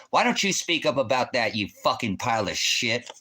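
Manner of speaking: ordinary talk, nothing marked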